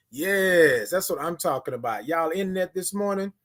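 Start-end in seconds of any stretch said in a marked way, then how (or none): none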